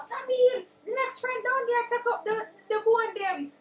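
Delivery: high, urgent holler to a friend; mock scream. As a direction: call out high as if across a distance. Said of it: "So she screamed out".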